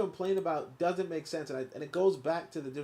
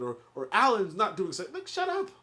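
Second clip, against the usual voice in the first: ordinary delivery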